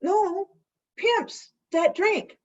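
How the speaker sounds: high squeaky insistence on Pimm's; playful high correction. Retold or imitated. Pam corrects, high pitched.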